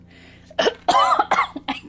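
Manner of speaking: sudden sharp choking cough. Real, not acted. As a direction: cough like something went down wrong. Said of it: "I'm choking on nothing".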